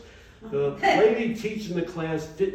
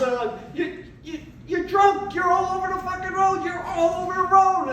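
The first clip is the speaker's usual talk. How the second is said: mock cop voice; 13 semitones higher than usual. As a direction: play the cop, pitched way up and mocking. Cop voice in retold traffic stop.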